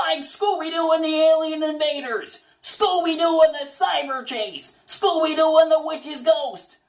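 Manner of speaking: high goofy Shaggy read. Acted. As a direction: slip into Shaggy for the movie names. Titles said in a Shaggy voice.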